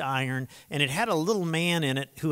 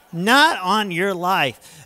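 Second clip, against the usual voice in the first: slow, louder, each word hit; punchline repeated slowly for the congregation; emphatic refusal; 5 semitones higher, 7 dB louder, much slower than usual. Real, not acted. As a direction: drag out Not on your life with heavy emphasis. Emphatic rhetorical answer, repeated.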